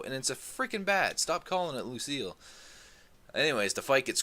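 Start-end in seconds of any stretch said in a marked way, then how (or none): none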